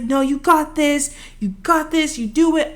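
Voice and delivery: imitated pep talk. Acts out professor's pep talk.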